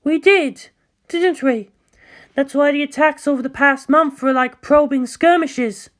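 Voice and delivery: high, dawning realization; shocked realization. Acted. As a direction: let it dawn on her. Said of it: "shocked realization".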